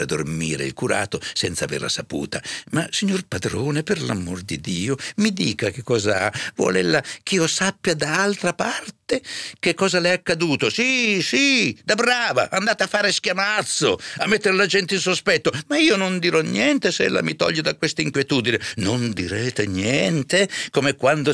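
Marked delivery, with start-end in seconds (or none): 2.7-10.7 higher, imploring servant voice; pleading housekeeper. A narrator's reading. Serva supplica il padrone. 10.7-15.5 very high, louder exasperated curate; sarcastic scolding. A narrator's reading. Curato rimprovera la serva con sarcasmo. 15.7-18.6 high, anxious promise; insistent housekeeper. A narrator's reading. Serva promette silenzio.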